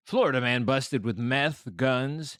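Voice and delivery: ordinary talk, nothing marked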